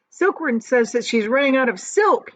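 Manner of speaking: loud, high call from below; urgent high yell. Acted. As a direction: shout up the warning. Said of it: "yelled the centipede from below".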